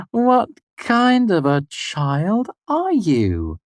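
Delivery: slow, high, scornful question; mock him slowly; derisive. A narrator's reading. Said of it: "full of derision".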